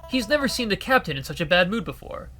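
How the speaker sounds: ordinary delivery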